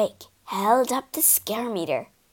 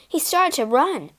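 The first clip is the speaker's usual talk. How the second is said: plain reading voice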